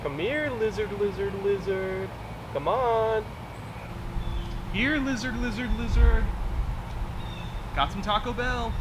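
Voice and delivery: high, soft coaxing voice; coaxing call. Acted. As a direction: lure a pet with a treat. Luring the dinosaur with food.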